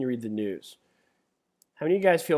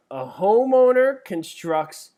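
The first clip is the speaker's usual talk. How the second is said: dramatic start; 7 semitones higher, 8 dB louder, slower than usual. Acted. Reads exam question aloud.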